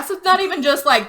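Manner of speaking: usual speaking voice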